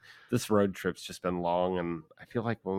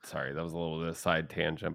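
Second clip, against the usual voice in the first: ordinary talk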